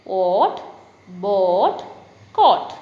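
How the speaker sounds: loud, separate rhyming words; loud word drill; read aloud. Shown as a demonstration. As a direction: call out each word for pupils to repeat. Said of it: "ഉറക്കെ പറയാനുള്ളത്".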